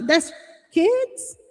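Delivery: put-on can't believe children are on the line; shocked disbelief. Mother hearing strangers on son's headset, stunned high question. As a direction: pitch shoots way up on kids, stunned. Shocked to hear kids online.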